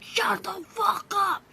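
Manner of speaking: loud high yell at the barking dog. Retold or imitated. Yelling at the barking dog to shut up, loud and high. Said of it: "and I'm like".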